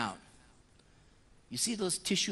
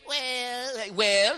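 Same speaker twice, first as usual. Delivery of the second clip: usual speaking voice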